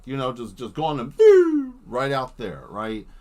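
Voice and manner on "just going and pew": pew sound effect. Acted. Sound effect of jetpack launch.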